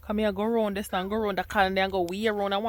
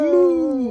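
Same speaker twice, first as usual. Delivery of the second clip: loud cow moo; 6 semitones higher, 10 dB louder than usual. Pretend. Mother imitates cow for toddler.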